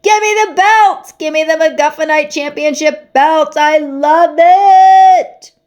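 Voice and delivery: high, loud, almost screaming fan; frantic excitement. Acted. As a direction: lose your mind over the belt. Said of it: "nearly fainting with excitement".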